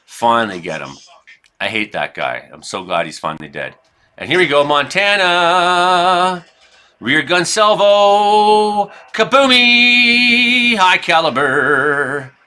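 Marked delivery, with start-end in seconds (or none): 4.2-12.3 drawn-out, much higher and louder battle call; hyped salvo call. Real, not acted. Gamer hyping his battleship salvo, slow high excited call. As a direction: call the shot like an announcer at a big moment. Big salvo fired in game.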